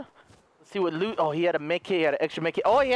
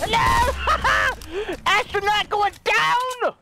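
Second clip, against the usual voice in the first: gleeful shout; 10 semitones higher, 4 dB louder, much slower than usual. Real, not acted. Shouting at enemy he shoots.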